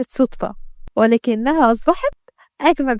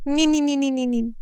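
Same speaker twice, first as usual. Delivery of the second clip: mocking nee nee; 3 semitones higher, 6 dB quieter than usual. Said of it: "وخرجت وهي متعصبة".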